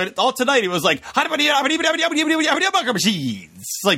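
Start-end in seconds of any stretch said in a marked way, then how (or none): 1.1-3.5 mock rapid-fire babble; motormouth imitation. Panelist mocking how the candidate sounded all tonight, motormouth. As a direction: gabble it like a speed-talker, no breath. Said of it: "he just talks too fast".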